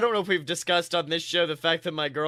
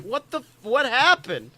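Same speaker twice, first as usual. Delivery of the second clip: mock surprise; 7 semitones higher, 4 dB louder than usual. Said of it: "I'm like".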